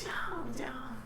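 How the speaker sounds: whispered quick command. Acted. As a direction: hiss it softly under breath. Hushed command to companion.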